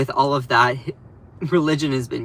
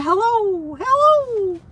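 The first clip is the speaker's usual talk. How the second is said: much higher, sing-song hello; silly morning hello; 4 dB louder, much slower than usual. Pretend. Vlogger greeting a viewer with her high hello. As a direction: chirp it up high and cute. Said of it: "the way that I say hello in the mornings".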